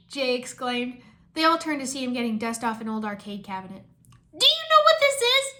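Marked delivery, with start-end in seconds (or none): 4.4-5.5 much higher, loud, thrilled voice; excited cry. Acted. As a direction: squeal it like a fan who found a treasure. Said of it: "he cried, excited".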